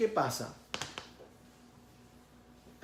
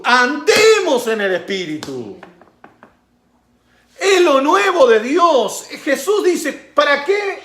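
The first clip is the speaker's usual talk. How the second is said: loud, high-pitched sermon with laughs; fiery preaching; 12 semitones higher, 16 dB louder than usual. Acted. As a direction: preach it at full force. Preaching at full force. (predicación fervorosa a todo pulmón)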